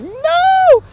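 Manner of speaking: very high, loud squealed "No!"; mock panic, a sharp yelped no; panicked denial. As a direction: shriek the denial like you've been caught. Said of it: "I was like".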